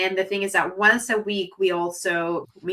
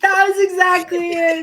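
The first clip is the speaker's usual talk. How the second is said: laughing shout, high and loud; 12 semitones higher, 8 dB louder than usual. Real, not acted. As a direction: cry it out through laughter. Laughing agreement.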